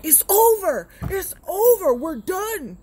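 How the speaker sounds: high, loud final declaration; furious breakup. Retold or imitated. Man ends the relationship, loud and furious. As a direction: end it in a rage. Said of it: "He's like".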